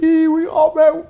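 high, loud, drawn-out imitated cheer; excited cheer. Friends celebrating after meeting [name], excited. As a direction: crow it slowly in a squeal.

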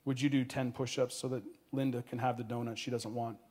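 low-key, softened question; hushed request, voice held down; quiet asking. A narrator's reading. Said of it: "Christensen quietly asked Steve".

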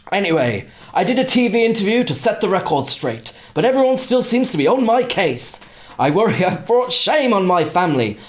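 high-pitched mock posh accent; Prince Andrew. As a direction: read it as a hammed-up posh letter-writer. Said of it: "try and do this in a posh accent".

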